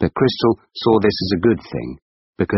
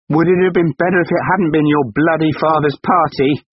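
high, loud furious retort; shouting; 10 semitones higher, 4 dB louder than usual. Acted. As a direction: hurl it back at him. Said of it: "she shouted".

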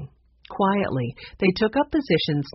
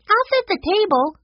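put-on very high, louder girlish voice; eager young girl; 5 dB louder than usual. A narrator's reading. Sue Lee offers to help with dinner, high eager girl voice. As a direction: pipe up eagerly as a little girl.

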